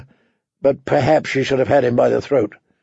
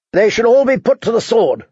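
put-on a spoiled young king demanding blood; boy king's declaration; 9 semitones higher than usual. A narrator's reading. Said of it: "Joffrey declared suddenly".

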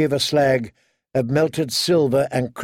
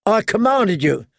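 higher-pitched indignant assertion; 6 semitones higher than usual. A narrator's reading. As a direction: assert authority, voice raised in pitch. Brother quarrels with sister over command.

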